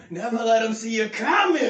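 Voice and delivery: high, drawling devil impersonation; Al Pacino impression. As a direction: drag the words out with mock relish. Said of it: "Pacino's sitting at the head".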